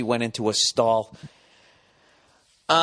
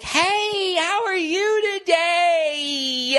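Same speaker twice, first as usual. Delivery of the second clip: very high, slow sing-song greeting; mock perky flight attendant drawing out each syllable; overly bubbly stewardess; much slower than usual. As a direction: stretch every word sweetly. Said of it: "adding syllables to fucking one syllable words".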